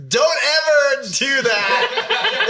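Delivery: laughing shout. Real, not acted. Laughing mock warning.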